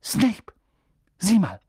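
high, sudden alarm; urgent exclamation. Acted. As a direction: blurt it out urgently. Girl urgently points out the culprit.

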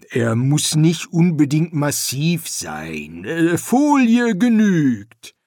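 an awkward builder hemming toward his point; hesitant builder. Acted. The builder admits foil will do, slowly and hesitantly.

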